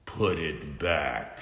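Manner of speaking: low, very slow stern order; measured hero warning; slow Batman command. Acted. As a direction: hero warning the robot slowly.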